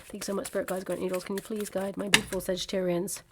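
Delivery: soft prayer to spirit guides. Real, not acted. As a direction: murmur a quick thank-you to the guides, then ask gently. Thanking spirit guides mid-reading.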